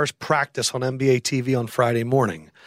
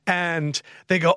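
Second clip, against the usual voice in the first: usual speaking voice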